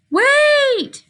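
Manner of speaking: wait, cried out very high; excited wait. Shown as a demonstration. Teacher demoing exclamation, high excited cry. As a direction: squeal the word wait, very high and sustained. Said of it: "So we've just got wait".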